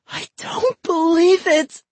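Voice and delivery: can't take in what she sees; horrified disbelief. A narrator's reading. Molly stares at her doll in the tank, anguished and slow.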